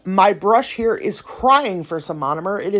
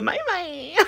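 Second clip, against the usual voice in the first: mock bye-bye; 7 semitones higher, 10 dB quieter than usual.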